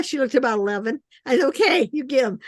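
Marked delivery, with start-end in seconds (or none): 1.5-2.5 chuckling through the words; laughing. Retold or imitated. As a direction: laugh while you say it. Retelling the offer, laughing.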